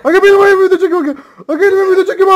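high, loud frantic begging; panicked pleading. Real, not acted. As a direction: beg them to stop, panicking. Pleading being chased in game. (panik içinde yalvarış)

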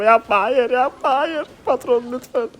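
rising into a high-pitched wail; desperate pleading. Real, not acted. Player begging the boss not to do it, desperate pleading. Pleading as attack goes wrong.

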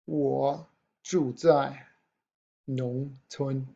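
我住在农村 spoken very slowly, syllable by syllable; slow model sentence. Shown as a demonstration. Teacher modelling 我住在农村 slowly for students. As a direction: stretch out 我住在农村 slowly for learners. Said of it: "models the sentence slowly".

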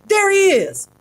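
high, loud cry from below. A narrator's reading. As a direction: shout it out as you spot him. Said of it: "a voice cried from below".

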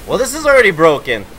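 normal talking voice

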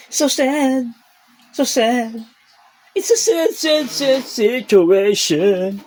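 very high, loud, slow mock lament; mock sad baby talk. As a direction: ham up the cat's sadness. (kunwaring malungkot na baby talk)

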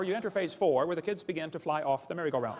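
usual speaking voice

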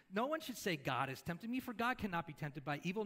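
ordinary delivery

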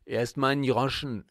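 outraged stall owner insisting; indignant demand. Acted. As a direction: demand the coin before she runs. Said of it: "verlangte sie empört".

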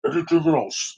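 mocking imitation of crying; hammed-up crybaby whine; Kylo Ren. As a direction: make fun of a crybaby's blubbering. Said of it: "Darth Vader never cried like that".

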